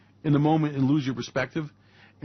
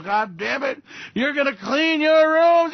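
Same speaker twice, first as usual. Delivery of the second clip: high, loud, slow parental yell; yelling at kids; 8 semitones higher, 4 dB louder, slower than usual. Retold or imitated. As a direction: bellow at the kids. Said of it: "when you scream at the kids and you yell".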